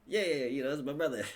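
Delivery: laughing light [name] voice; mock [name]. Podcaster mocking [name], awkward laughing. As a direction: play it off awkwardly. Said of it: "[name] be like".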